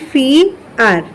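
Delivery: ফি আর split, "fee", pause, "ar"; I-vowel pronunciation. Shown as a demonstration. Teacher demonstrates the i sound, ফি and আর separated. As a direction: hold ফি on its i, then separate আর. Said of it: "উচ্চারণ হচ্ছে রস্বীকারের মতো".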